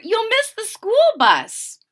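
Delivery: high, loud call of a hurrying mother. Acted. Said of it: "said his mother".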